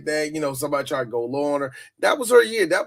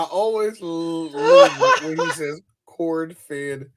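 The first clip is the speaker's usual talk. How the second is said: words stretched out through laughter; can't stop laughing while saying it; slow, giggly delivery; 2 semitones higher, 5 dB louder, much slower than usual. Real, not acted.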